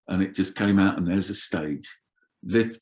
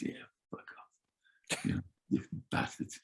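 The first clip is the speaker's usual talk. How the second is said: whispered curse under breath; 13 dB quieter than usual. Retold or imitated. Tour manager whispering curses at the departing police. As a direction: curse them quietly so they can't hear.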